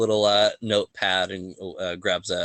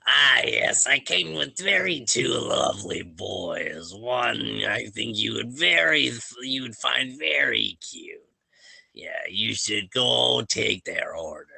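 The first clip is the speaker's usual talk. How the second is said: goblin woman; 3 semitones higher than usual. Acted. Goblin woman teasing the table about two lovely boys. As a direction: slur it like a tipsy old goblin matchmaker. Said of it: "the goblin woman. She goes".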